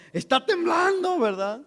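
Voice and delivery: put-on like a kid delighted by the quake; excitedly announcing the tremor; thrilled. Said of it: "¿Pero por qué se emociona tanto?".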